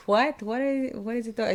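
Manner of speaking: high-pitched retold bafflement; baffled. Retells own baffled reaction to film.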